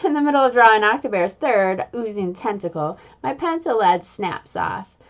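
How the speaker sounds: plain reading voice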